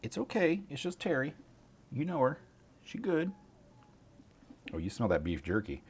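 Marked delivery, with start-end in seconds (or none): 0.0-3.3 high, gentle pet-talk; soothing the dog. Real, not acted. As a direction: reassure the dog it's only Terry. Reassuring the dog.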